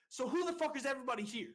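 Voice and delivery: high, loud demand; retold angry yell. Recruit leader to both divisions, angry shouted demand. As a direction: re-enact shouting the question to the whole crowd. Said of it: "And I just kept getting angry".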